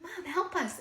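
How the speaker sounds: put-on high-pitched child's plea, not full volume; mild imitated scream for help; retold kids' screams. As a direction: imitate children crying out for their mother. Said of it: "my kids screaming in the background".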